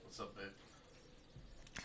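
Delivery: quiet. Acted. Sleepy Hugh greeting.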